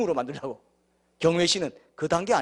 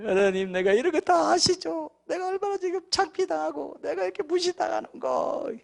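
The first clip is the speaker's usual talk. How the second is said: whining prayer; 11 semitones higher than usual. Acted. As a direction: stretch the complaint out pitifully. Whining dawn prayer of self-pity.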